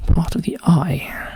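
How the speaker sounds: slow whisper, pitch lifting; whispered musing. Real, not acted. Solver rereading the clue under his breath, slow whisper. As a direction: turn the words over in a whisper.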